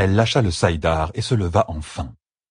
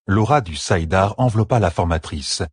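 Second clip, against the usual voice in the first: plain reading voice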